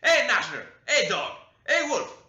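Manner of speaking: very loud, high calls to the dogs; old servant urging on the dogs, shouting; calling the dogs. A narrator's reading. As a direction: holler the dogs into attack. Old man sets dogs on him.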